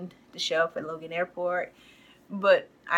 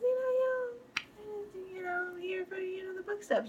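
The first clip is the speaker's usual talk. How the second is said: high, soft evasive voice; Irvin; 12 semitones higher, 8 dB quieter than usual. Retold or imitated. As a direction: mimic someone quietly dodging the question. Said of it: "He goes".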